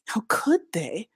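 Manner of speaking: slow, hushed exclamation of shock. A narrator's reading. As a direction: exclaim it slowly, under the breath in disbelief. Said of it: "the gleaners exclaim of us".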